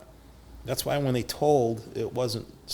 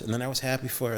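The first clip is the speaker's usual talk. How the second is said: ordinary talk, nothing marked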